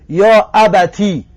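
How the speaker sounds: «یا ابتی» slow, with "ti" on kasra; kasra on ta demo. Shown as a demonstration. Teacher modelling the kasra form, slow. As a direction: stretch «یا ابتی», ending in a clear "ti". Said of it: "کسرش بتیم".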